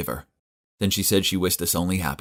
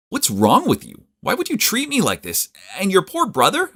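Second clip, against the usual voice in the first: higher, louder, outraged accusing; furious confrontation; 13 semitones higher, 4 dB louder than usual. Acted. Confronting Elliot on the spot, furious and shouting. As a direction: let the betrayal burst out loud.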